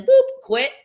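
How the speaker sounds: squeaky high sound effect; high boop. Acted. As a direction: pop it out high and sharp.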